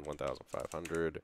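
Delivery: ordinary talk, nothing marked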